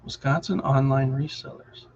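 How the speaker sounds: usual speaking voice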